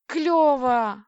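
high, loud cheer of delight; gleeful, drawn-out exclamation. A narrator's reading. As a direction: exclaim it, thrilled. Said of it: "обрадовалась я".

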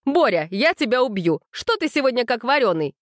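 mildly acted exasperation; scolding. A narrator's reading. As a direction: scold a helper with lively rises and falls. Coach scolding her helper.

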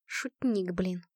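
low, soft grumble; under-the-breath annoyance; grumbling. A narrator's reading. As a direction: mutter it sourly to yourself. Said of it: "проворчала Натка".

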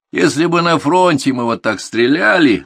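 slow, high, loud harangue; indignant scolding. A narrator's reading. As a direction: berate the whole squad. Instructor begins a scolding speech.

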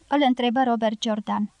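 ordinary delivery, nothing marked

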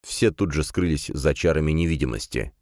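ordinary delivery, nothing marked

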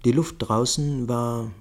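ordinary delivery

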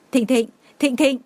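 frantic mother calling her lost son by name. A narrator's reading. Mother calls out to her lost son, frantic and desperate. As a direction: call out into the rain, desperate.

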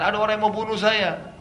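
high-pitched frightened plea; wounded man crying out in fear; panicked cry. Retold or imitated. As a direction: cry out in terror for help. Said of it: "Abu Rafi' mengatakan".

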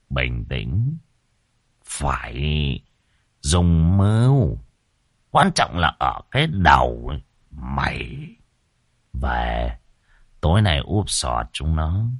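low, soft, slow-paced master's counsel; cryptic scheming. A narrator's reading. Master telling his disciple they will use cunning tonight, cryptic and hushed. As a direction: keep it low and drawn-out, like a secret plan. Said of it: "Lão sư phụ cười bí hiểm".

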